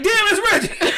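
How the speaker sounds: surprised shout. Retold or imitated. Spotting their knocked-out friend on the street, high surprised shout. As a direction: yell it on recognizing him.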